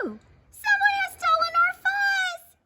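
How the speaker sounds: very high, loud outcry; alarmed cry. A narrator's reading. Pipsqueak discovers the theft, crying out. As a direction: squeal the alarm to everyone. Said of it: "cried Pipsqueak".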